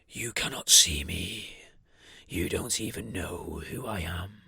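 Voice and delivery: deep, slow, menacing; low slow sinister entity. Acted. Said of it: "in that such of a sinister voice".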